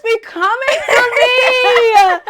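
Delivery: drawn-out, high-pitched laughing shout; loud mock-tearful wail; wailing complaint. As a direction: holler it slowly in hammed-up despair. Comic complaint about New Yorkers.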